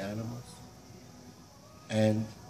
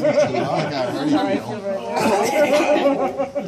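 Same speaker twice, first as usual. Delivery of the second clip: loud laugh; 12 semitones higher, 14 dB louder than usual. Real, not acted. Laughing at own joke.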